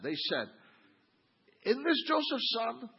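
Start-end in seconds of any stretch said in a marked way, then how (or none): 1.6-2.9 put-on scoffing at the hometown boy; sneering question, pitched up. Shown as a demonstration. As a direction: congregation sneering at Jesus, scornful high question. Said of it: "tell me what they said".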